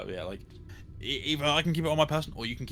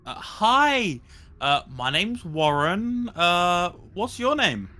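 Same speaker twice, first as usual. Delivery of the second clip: gentle high voice; 6 semitones higher, 5 dB louder, much slower than usual. Acted. Player voices Warren to rat.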